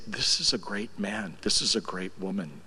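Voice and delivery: put-on hushed and tender, for kids; gentle explaining. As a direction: tell the little ones gently. Explaining Denny to young grandkids.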